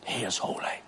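near-whisper, low and very soft; hushed reverence. Acted. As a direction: drop to an awed hush. Awed aside after shouted climax.

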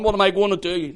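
high, loud despairing question; desperate cry. Retold or imitated. Peter at a loss after failing Jesus, desperate shout. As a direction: shout it as a helpless plea.

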